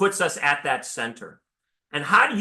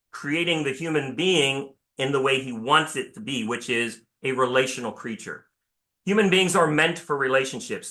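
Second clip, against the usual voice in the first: ordinary talk, nothing marked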